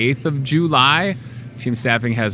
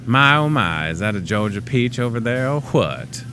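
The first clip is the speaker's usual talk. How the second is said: mock Southern accent. TV host teasing viewers with a put-on Southern accent. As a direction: play a Georgia gentleman admiring a Southern belle, in a Southern accent. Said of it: "that was like Morgan Freeman and Kevin Spacey".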